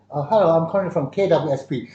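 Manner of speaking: mock Hong Kong accented English. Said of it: "cakap English in a Chinese accent".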